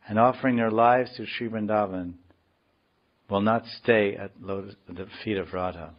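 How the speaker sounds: emphatic opening, settling down as it ends; declaimed verse in measured phrases; scripture reading. Acted. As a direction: read the translation out deliberately. Reads other translation.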